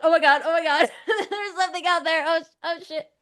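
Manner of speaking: mock panic, laughing and high; laughing half-scared panic; panicked. Telling of first alien contact, mock panic with laughs. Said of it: "I was terrified".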